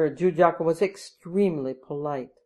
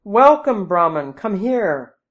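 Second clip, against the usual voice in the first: loud, high-pitched joyful welcome; ringing, happy greeting; happy call; 4 semitones higher, 7 dB louder, slower than usual. A narrator's reading. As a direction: call out gladly to an approaching guest. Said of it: "called out happily".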